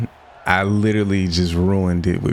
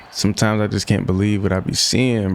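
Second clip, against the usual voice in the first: normal talking voice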